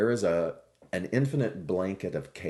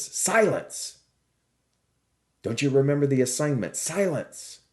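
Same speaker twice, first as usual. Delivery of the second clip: high, loud, sharp rebuke; the master shouts his pupil down to silence; stern teacher's scolding yell, much higher and louder; 8 semitones higher, 6 dB louder than usual. Acted. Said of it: "the teacher scolds him".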